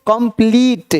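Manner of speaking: कंप्लीट ending in a long "pleet"; long ee vowel. Shown as a demonstration. Teacher introducing the correct reading, long ee. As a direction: hold the ई in कंप्लीट. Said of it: "कंप्लीट नहीं".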